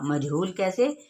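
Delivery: ordinary delivery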